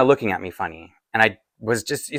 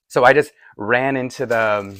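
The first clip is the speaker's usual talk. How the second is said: ordinary talk, nothing marked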